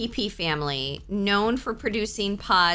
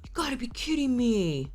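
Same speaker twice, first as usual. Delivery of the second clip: retold shock; 8 semitones higher than usual. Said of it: "I was like".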